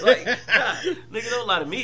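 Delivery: high, loud, laughing protest; laughing disbelief. Real, not acted. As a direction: laugh through your objection. Laughing disbelief at friend's claim.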